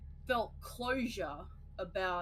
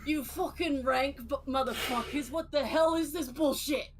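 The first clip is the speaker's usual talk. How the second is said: Aussie bogan; 5 semitones higher, 5 dB louder than usual. Pretend. Mock Aussie bogan rant.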